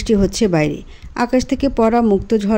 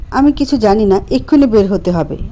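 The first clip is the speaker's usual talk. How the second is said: usual speaking voice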